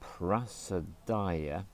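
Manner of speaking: "Prasadaya" drawn out slowly, syllable by syllable. Shown as a demonstration. Teacher listing mantra parts, slow Prasadaya. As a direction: stretch "Prasadaya" long and slow. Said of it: "that's the long one".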